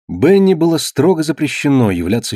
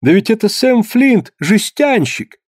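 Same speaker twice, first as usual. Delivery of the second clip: surprised exclamation; 11 semitones higher, slower than usual. Acted. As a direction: exclaim it, suddenly recognising him. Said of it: "воскликнула Эбби Таннер".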